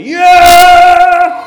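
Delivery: very loud, high, drawn-out cheer; really excited. Shown as a demonstration. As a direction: demonstrate jumping up with a huge shout. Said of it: "when you're really excited".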